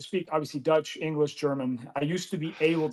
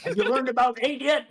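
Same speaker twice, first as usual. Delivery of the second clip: mock patient teacher; 7 semitones higher than usual. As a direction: explain numbers like to a toddler, laughing.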